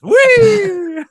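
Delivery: high, loud mock squeal of glee; a long, high party "wheee"; gleeful whee. Joking about the killer having a blender party, gleeful squeal.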